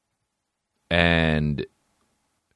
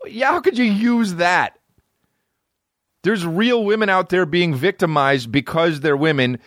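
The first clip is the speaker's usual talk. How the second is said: heated rant; 8 semitones higher than usual. Real, not acted. Ranting about fake victim.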